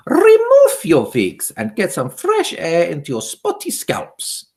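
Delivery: Grand High Witch barking at witches; witch's shout. A narrator's reading. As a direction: shout it with contempt. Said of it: "she shouted".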